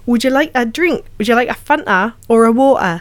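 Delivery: learner's Geordie with a lilting question; practice Geordie accent. Shown as a demonstration. Actor showing co-host her practice sentences, attempted Geordie accent. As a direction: have a careful go at Geordie. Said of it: "lots of different practice sentences".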